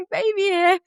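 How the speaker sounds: put-on high, giddy baby here; giddy announcement. Mother telling midwife friend baby arrived, high giddy. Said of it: "I'm like".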